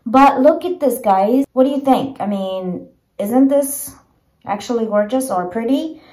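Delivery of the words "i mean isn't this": ordinary talk, nothing marked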